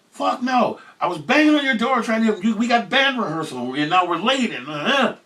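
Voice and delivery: high, loud, fed-up protest; annoyed bandmate. Retold or imitated. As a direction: insist he never called. Bandmate insisting on phone.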